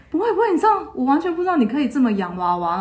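mock eager protest; eager reassurance. Senior insists, laughing.

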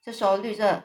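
ordinary delivery

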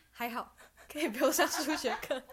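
giggling through the words; half-laughing glee; laughing relief. Retold or imitated. Relieved joke about skipping math.

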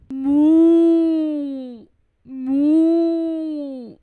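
loud, long drawn cow moo; mooing like a big cow. Acted. Storyteller imitating the cow with a loud moo.

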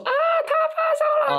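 very high mock shriek of alarm; mother's panicked shriek. Mother yelling up from the first floor about fever, shrill panicked shriek. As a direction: shriek it like a frantic mom downstairs. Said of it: "我妈就说".

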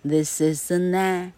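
"an a" linked, the N carried onto "a"; linking demo. Shown as a demonstration. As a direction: run the N of "an" straight into "a". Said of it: "这个子音需要跟后面的母音去做连音".

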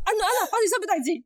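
dad calling out. Retold or imitated. Dad rushes over, calling out.

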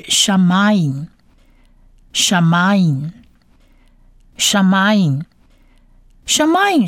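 "shamayim" slowly, as "sha-ma-yim"; whole-word demo. Shown as a demonstration. Teacher reads the whole word for learners, "shamayim" slow and clear. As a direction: repeat "shamayim" slowly with long pauses. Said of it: "这整个字发起来就是".

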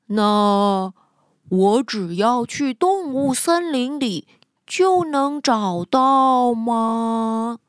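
unhurried, drawn-out sloth; slow sloth voice. Acted. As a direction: ask slowly and lazily. Sloth asks again.

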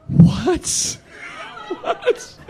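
high rising incredulous what, then a breathless squeak; can't believe his ears; stunned disbelief. Retold or imitated. Brother learns of the lie, high incredulous squeak.

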